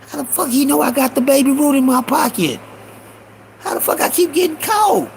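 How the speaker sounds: high, incredulous questioning; exasperated disbelief. Retold or imitated. Young thief wonders how he keeps getting caught, high and exasperated. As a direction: wail the question mildly, pitched well up. Boy baffled at being caught.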